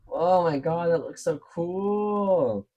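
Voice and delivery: high, slow, thrilled reveal; real delight at how cool it turned out; delighted gasp. Real, not acted. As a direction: gush over the finished piece. Unmolding the finished resin broomstick.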